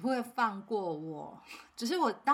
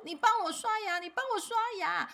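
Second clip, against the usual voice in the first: put-on high whiny child's plea, much higher pitch; crying child; 12 semitones higher than usual. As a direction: play the sobbing little boy begging mom. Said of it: "然后开始就哭得非常的惨".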